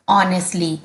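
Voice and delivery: Honestly said on-est-ly, the H silent. Shown as a demonstration. Teacher models honestly for students, h silent. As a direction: open Honestly on the vowel with no h. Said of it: "एच बच्चों silent रहेगा".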